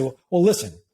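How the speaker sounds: firm, low interrupting command. Shown as a demonstration. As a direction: cut in with authority. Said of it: "which is a command".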